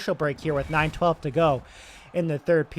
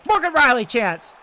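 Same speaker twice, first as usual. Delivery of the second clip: excited chance call; 7 semitones higher, 5 dB louder than usual. Acted. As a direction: jump high and loud on the name. Sudden chance in front.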